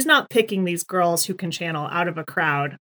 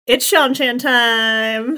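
loud, drawn-out fanfare; excited announcement; 3 semitones higher, 6 dB louder, much slower than usual. Real, not acted. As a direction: announce it slowly and loudly, thrilled. Announces the favourite scene's arrival.

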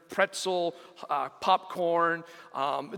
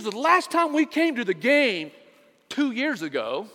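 angry daughter; 4 semitones higher, 4 dB louder than usual. Retold or imitated. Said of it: "she got angry".

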